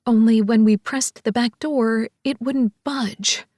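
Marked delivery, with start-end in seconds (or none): none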